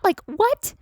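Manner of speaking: real shock spilling out at the studio; incredulous outburst. Real, not acted. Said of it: "made me go completely bonkers crazy".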